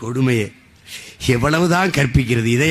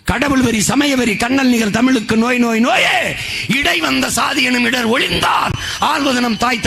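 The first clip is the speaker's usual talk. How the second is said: high, ringing verse declamation; poem declaimed; 8 semitones higher than usual. Acted. Orator quotes the revolutionary poet, impassioned declamation. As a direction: recite it like a rally battle cry. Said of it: "புரட்சி பாவலானது என்ன?".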